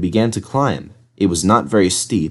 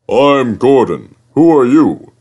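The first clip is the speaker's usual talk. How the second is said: big engine voice; 4 semitones higher, 5 dB louder than usual. A narrator's reading. Gordon voiced greeting.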